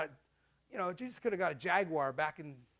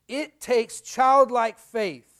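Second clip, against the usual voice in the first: slow emphasis; 2 semitones higher, 10 dB louder, much slower than usual. Acted. Drawn-out sermon emphasis.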